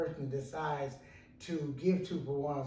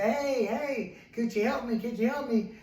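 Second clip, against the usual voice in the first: raised, higher-pitched call for help; retold scream; 7 semitones higher, 4 dB louder than usual. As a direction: call up to someone passing by, a mild mock yell. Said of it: "he screams out and says".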